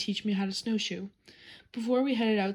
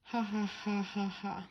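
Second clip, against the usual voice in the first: softer, mock hysterical giggling; hysterical laughing; 5 dB quieter, slower than usual. A narrator's reading. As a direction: laugh at your brother, softly falling away. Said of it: "Susie started laughing hysterically".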